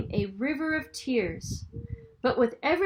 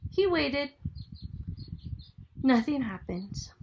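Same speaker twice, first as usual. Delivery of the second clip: plain reading voice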